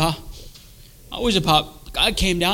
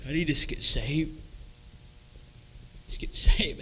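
soft urging; 9 dB quieter than usual. Retold or imitated. Wife urging, retold softly.

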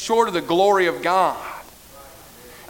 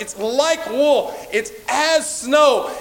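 slow emphatic; 6 semitones higher, much slower than usual. Acted. Emphatic slow scripture phrases.

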